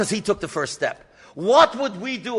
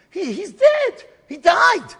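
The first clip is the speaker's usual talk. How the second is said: high, loud panicked cry that he's dead; 11 semitones higher, 7 dB louder, slower than usual. Retold or imitated. An old woman finding her husband dead, high panicked cry. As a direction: shout it high in horror.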